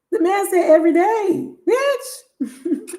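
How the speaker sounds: high, laughing outrage at every day; outraged laugh. Real, not acted. Telling girls about the suitor, laughing in outrage. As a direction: cry it out through laughter.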